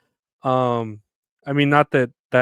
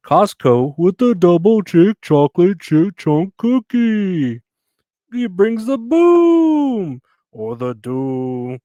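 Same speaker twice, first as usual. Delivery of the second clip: slow, loud announcer voice, pitch raised; hammed-up ad voice; 6 semitones higher, 8 dB louder, slower than usual. Vlogger mocking a Costco cookie ad, big slow announcer voice. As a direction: boom it out like a cheesy commercial. Mock ad slogan read.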